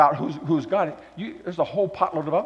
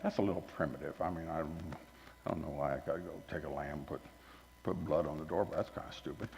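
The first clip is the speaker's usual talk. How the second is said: much softer, lower, scoffing aside; mock dismissive; 11 semitones lower, 17 dB quieter than usual. Sophisticated Egyptians scoffing at the lamb blood, low and soft. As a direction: mutter it like it's beneath you. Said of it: "they can very gladly say".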